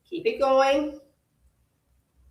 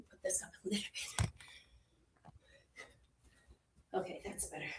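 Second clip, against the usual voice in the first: fast, soft whisper to herself; whispered aside; 4 semitones lower, 21 dB quieter, much faster than usual. Real, not acted. Instructor fixing her camera, whispering to herself. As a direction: whisper quickly while fixing something.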